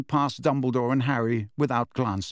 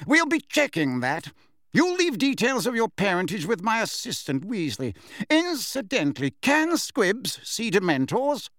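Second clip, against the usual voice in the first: higher-pitched pompous official; Fudge, suspicious and officious; 9 semitones higher than usual. Acted. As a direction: question the witness with lofty doubt.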